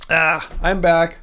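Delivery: normal talking voice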